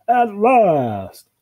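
loud, high, drawn-out cheer. Acted. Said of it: "cheered Bill".

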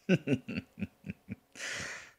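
breathy, drawn-out chuckle. Acted. Demon teasing an angel about their slim odds, amused chuckle. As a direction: chuckle to yourself at your own joke.

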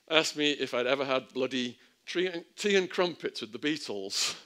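a hint of a hammed-up British accent; fake British accent. Two Tonys easing a nervous inmate, lightly fake British accent. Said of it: "in a fake British accent".

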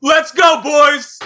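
loud celebratory shout; frat bro. Real, not acted. Celebrating the pull.

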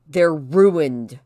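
loud, outraged accusation; angry outburst. A narrator's reading. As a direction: burst out in indignation. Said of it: "she burst out".

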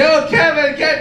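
high, swooning call of the name; hammed-up breathless-with-love call; mock passionate cry. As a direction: imitate an adoring lover. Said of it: "it used to be".

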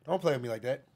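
usual speaking voice

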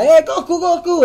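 llamado agudo y fuerte; gritos imitados. Retold or imitated. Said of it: "empiezan a gritar". (gritos de pibes, imitados)

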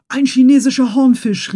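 the harpooner calls out the fish's name loudly; calling out. A narrator's reading. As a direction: call it out, pointing at the fish. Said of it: "rief Ned Land".

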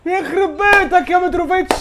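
high, loud alarmed yelling; exasperated mom scolding. Real, not acted. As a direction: shout in alarm at the screen. Dangerous header on goal.

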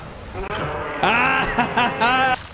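high hammy villain laugh; evil cackle. Acted. Gloating over the foe with a high cackle.

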